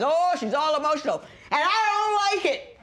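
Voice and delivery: high, loud mocking whine with a laugh; mocking a whiny man. Judge mocking the husband's complaint, high whiny voice. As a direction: mimic a man complaining about his emotional partner. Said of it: "Men always say".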